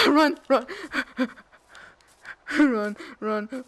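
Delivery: loud laughing run shouts; laughing panic. Real, not acted. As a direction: laugh and yell while fleeing. Fleeing mob, laughing.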